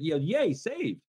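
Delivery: high-pitched little cheer; imitated happy call; mock relief. Acting man relieved at being spotted.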